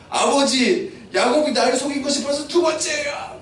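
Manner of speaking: high-pitched, wailing complaint; tearful protest. Acted. Wronged son to his father, tearful high protest. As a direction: cry out in acted anguish. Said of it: "눈물을 흘리기 시작하는 거예요".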